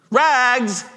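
high, loud street call; ringing ragman's cry. A narrator's reading. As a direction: cry the wares out slowly and high. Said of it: "cried the sobbing, bleeding, strong".

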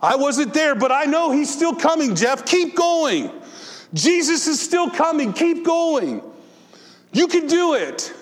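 put-on an old pastor urging him on, high and eager; Reverend Prowse encouraging. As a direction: cheer your young friend to keep going. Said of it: "I can hear Reverend Prowse say".

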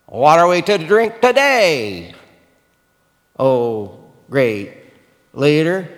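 mock the great leader with a grumble; a hint of a bitter complaining crowd; grumbling sarcasm. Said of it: "the people grumbled against Moses".

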